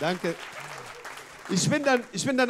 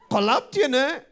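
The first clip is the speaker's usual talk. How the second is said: mock slang girl; 5 semitones higher, 6 dB louder, slower than usual. Friend's slurred question, acted.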